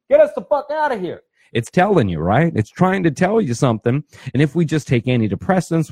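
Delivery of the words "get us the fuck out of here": mock panic, pitched much higher; the mind screaming to escape, half-hammed; panicked alarm. The body begging to flee, mock panicked high cry. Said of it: "your body and your mind is going".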